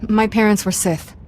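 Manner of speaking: ordinary delivery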